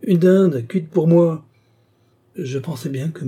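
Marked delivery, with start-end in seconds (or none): 0.0-1.4 high, loud outraged cry; furious exclamation. A narrator's reading. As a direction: rage over the stolen turkey. Carquebus furious over his turkey.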